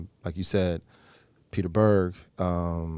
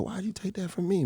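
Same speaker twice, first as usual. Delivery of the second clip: much higher, softer imitated complaint; mock protest; 11 semitones higher, 6 dB quieter than usual. Tony asking about borrowed mannerisms, mock protest. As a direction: lightly imitate a high-pitched, puzzled objection.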